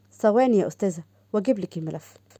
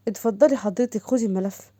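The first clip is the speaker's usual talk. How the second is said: ordinary delivery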